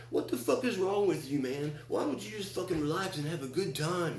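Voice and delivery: high-pitched mock complaint; high, whiny retold scolding; exasperated friends. Friends confronting him at campfire.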